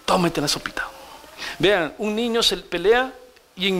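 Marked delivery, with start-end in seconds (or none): 0.1-0.9 whispered coaxing. Retold or imitated. Teacher whispering to Pepito to eat the soup. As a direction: whisper "Tómate la sopita" gently. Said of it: "así vamos a tener que enseñar".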